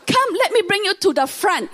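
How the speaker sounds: high, coaxing welcome; eager pastor. Retold or imitated. Pastor eagerly leads the guest forward, high and coaxing. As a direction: usher her forward with eager sweetness. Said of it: "the pastor was so nice".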